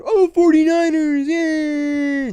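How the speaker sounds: high excited cheer; mock fan cheer. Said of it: "to be screaming".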